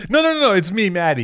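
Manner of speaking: put-on playful reveal to old friend; mock excited reveal. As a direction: reveal yourself with glee.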